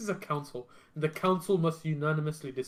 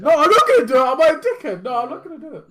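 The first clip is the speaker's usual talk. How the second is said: loud refusal; 10 semitones higher, 10 dB louder than usual. Real, not acted. Refusing loudly, laughing.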